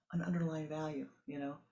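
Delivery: normal talking voice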